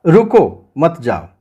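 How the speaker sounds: रुको set apart, brief pause, then मत जाओ; pause after रुको. Shown as a demonstration. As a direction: break after रुको, keep मत जाओ together. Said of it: "मतलब आपको रुकना है".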